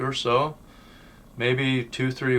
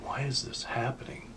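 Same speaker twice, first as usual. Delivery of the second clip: hushed, frightened whisper; whispered fear; 3 semitones higher, 10 dB quieter than usual. Retold or imitated. Frightened boy alone in a haunted house, whispering. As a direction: breathe it out scared and unvoiced. Scared by ghosts.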